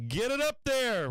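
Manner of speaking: loud, high shouts urging the market on; shouting at the screen for a squeeze; yelling at the chart. Real, not acted. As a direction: cheer the trade up at full voice.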